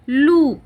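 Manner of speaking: लू with the oo stretched and lifted; long oo sound. Shown as a demonstration. Teacher showing the long u in the English word loop. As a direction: pull the oo in लू out long. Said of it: "हमने ओ को खींचा है".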